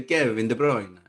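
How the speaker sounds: loud, playful Italian accent; mock-Italian, thrown out loudly. Shown as a demonstration. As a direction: put a bit of Italian accent on it. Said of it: "a bit of an Italian accent".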